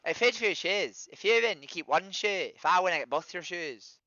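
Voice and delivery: hammed-up Irish lilt; Irish accent on request. Shown as a demonstration. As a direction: show off your bad Irish accent. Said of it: "do your accent again".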